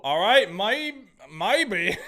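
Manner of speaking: a hint of an Australian accent slipping into maybe; slipped into an Australian accent mid-word; accidental Australian. Real, not acted. Gamer reacting to his hockey team, a hint of Australian accent. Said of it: "I turned Australian for a second there".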